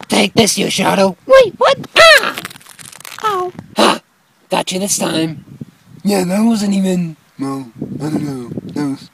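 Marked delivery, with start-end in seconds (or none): 1.2-3.5 startled high yelp. Acted. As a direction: yelp as the snowball hits. Hit by snowball.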